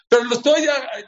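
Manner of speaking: high, loud protest; emphatic objection. Acted. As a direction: push back indignantly. Objection voiced mid-sermon. (objeción enfática, aguda y fuerte)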